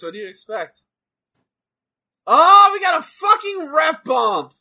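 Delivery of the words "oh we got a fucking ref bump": high, loud thrilled yell; excited shout. Real, not acted. Excited reaction to ref bump.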